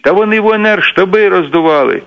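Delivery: mock yell of indignation, voice pitched well up; retold shout. Regional leader running in from the corridor, mildly shouting. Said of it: "кричить".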